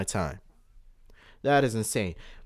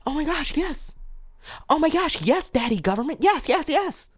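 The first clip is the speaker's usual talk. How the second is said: very high, squeaky mock of a yes-man; mocking sheep voice. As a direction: sneer at the blind followers in a silly high voice. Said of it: "That's what y'all sound like".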